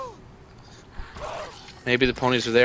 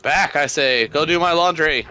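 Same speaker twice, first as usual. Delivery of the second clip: higher, louder mock command; 5 semitones higher, 4 dB louder, slower than usual. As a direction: order them off grandly. Mock commanding zombies away.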